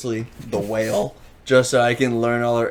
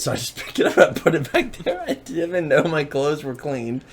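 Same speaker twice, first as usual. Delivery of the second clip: normal talking voice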